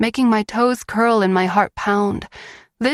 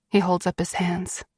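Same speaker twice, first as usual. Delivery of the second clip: ordinary delivery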